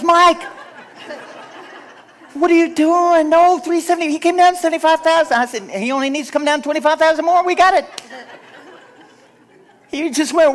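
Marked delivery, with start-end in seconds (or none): 0.0-5.4 high, loud alarmed realtor voice; imitating a panicking realtor, pitched up and loud; panicked realtor. Realtor panicking at low offer. 5.7-7.9 excited insistence. Retold or imitated. Pastor insisting to realtor.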